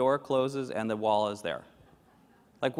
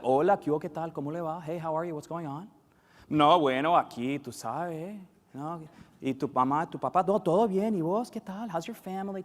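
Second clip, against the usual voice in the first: lightly acted Colombian small talk; chatty Colombian greeting; 3 semitones higher than usual. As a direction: rattle through friendly greetings. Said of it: "especially in Colombia, it's like".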